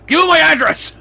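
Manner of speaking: very high, loud playful yell; mock challenge shout. Friend daring Andy's father through Andy, mock challenge shouted. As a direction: holler it like a playful dare.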